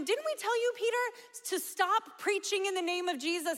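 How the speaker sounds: high, indignant Sanhedrin voices; scolding accusers. Retold or imitated. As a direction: sneer it like offended judges. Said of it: "they're telling him".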